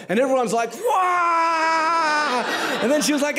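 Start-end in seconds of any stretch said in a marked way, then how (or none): 0.7-2.4 high shouted wah; mock crowd cheer. The pastor imitates students cheering Sachi's sermon with a high shouted wah.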